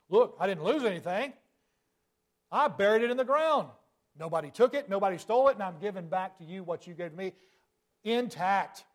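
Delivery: lazy servant excusing himself to his master, indignant; defensive servant. Acted. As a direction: plead your innocence to the master. Servant defending buried talent to master.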